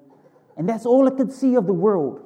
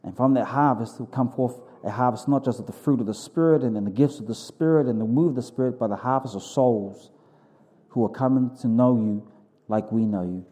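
fervent, earnest pastor's prayer; 7 semitones lower than usual. Acted. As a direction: pray it out boldly to God. Leads the congregation in prayer.

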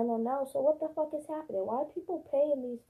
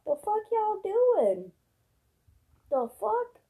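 high, loud and slow; outraged outburst; 9 semitones higher, 5 dB louder, slower than usual. Real, not acted. Ranting about syringes on the sidewalk, outraged. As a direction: yell it at the whole street.

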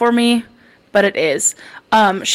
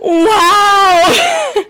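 mock offended; 10 semitones higher, 4 dB louder than usual. Mock offended at hometown jab.

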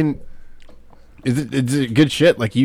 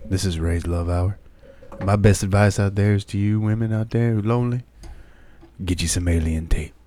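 sultry perfume ad; 4 semitones lower, 6 dB quieter than usual. Acted. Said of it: "his deep, sultry voice".

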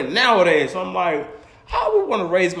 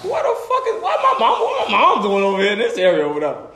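high, laughing disbelief; high shocked laugh-question; incredulous; 3 semitones higher than usual. Retold or imitated. Shocked at mom's location.

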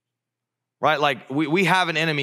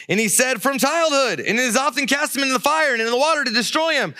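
high, urgent reading voice; dramatic Scripture reading; 12 semitones higher, 4 dB louder than usual. Acted. Preacher reading verse twenty to the room, dramatic. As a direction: read the story out with mounting intensity.

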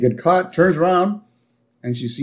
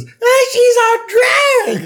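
very high, loud screechy mock shout; Helen Lovejoy; 5 dB louder, much slower than usual. Gossip accusing the girl, very high slow mock screech. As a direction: drag it out in a hammed-up falsetto shriek. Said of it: "Helen Lovejoy jumps in".

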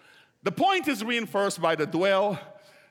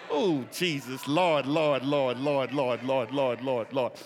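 low murmured Lord, Lord under the breath; murmured prayer; 9 semitones lower, slower than usual. Real, not acted. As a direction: pray it low, almost to yourself.

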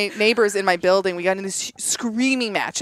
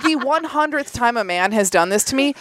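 usual speaking voice

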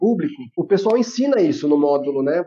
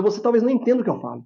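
very fast; 3 semitones higher, much faster than usual. Shown as a demonstration. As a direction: rattle it off at double speed. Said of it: "Se eu falar muito rápido".

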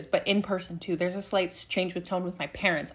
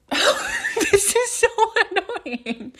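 much higher, drawn out through a laugh; laughing exasperation; 5 dB louder, much slower than usual. Retold or imitated. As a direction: laugh out the complaint, stretched slow.

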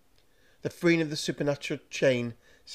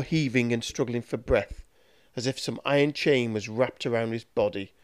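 usual speaking voice

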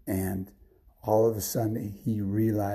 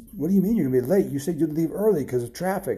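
higher-pitched, annoyed questioning; mad boss; 5 semitones higher, faster than usual. Acted. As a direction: scold a late employee. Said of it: "his boss was mad".

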